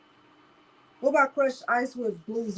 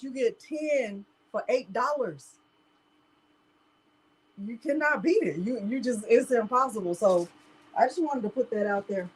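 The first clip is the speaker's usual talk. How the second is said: ordinary delivery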